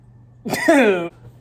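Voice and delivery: a loud falling laugh; last laugh. Real, not acted. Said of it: "I got that out of my system".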